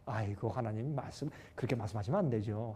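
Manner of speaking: low, hushed mock protest to God; devil protesting. Devil answers God's challenge about Job, low soft protest.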